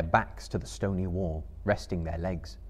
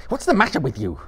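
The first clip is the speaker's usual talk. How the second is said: loud, higher exasperated question; sharp demand; 6 semitones higher, 9 dB louder, faster than usual. A narrator's reading. Frodo to screaming Gollum, sharp demand.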